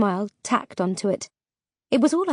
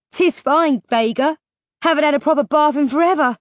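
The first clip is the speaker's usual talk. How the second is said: higher, louder, quick character voice; Petra, amused; 6 semitones higher, 5 dB louder than usual. A narrator's reading. Petra reassures Vega after being doused, brightly amused. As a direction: brush off a soaking in high good humour.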